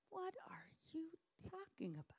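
high, breathless whispered question; baffled whisper. Acted. Disciples baffled by Jesus, whispering high and stunned. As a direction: whisper it in bewilderment.

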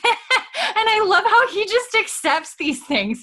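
laughing through the words, pitch up; real giggle breaking into the sentence. Real, not acted. Host laughing while telling her co-host about Jamie.

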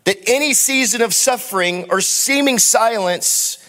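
rising, fervent sermon delivery over the band; impassioned preaching. Acted. Pastor to congregation, fervent preaching. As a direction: preach it out with building conviction.

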